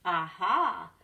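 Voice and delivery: a loud, bright discovery; aha moment. Acted. George figuring out clouds, loud eureka. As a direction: announce the discovery.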